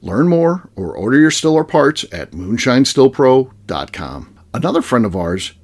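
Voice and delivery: low, measured podcast ad read; sponsor ad read. Real, not acted. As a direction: read the sponsor copy smoothly. Host reads sponsor message.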